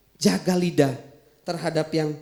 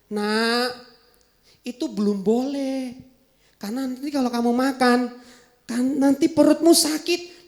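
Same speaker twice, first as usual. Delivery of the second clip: gentle mother; 4 dB quieter than usual. Acted.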